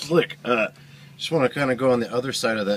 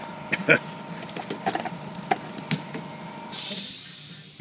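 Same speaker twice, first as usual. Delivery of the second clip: breathy laugh; 11 semitones higher, 6 dB quieter than usual. Real, not acted. Laughs at impossible tight spot.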